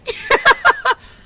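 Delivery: bright silly giggle; high laugh. Real, not acted.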